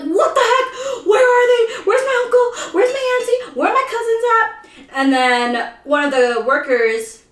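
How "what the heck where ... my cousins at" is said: high, loud, whiny mock panic; panicking. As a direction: wail it like a lost kid, hammed-up. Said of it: "I'm, I'm panicking".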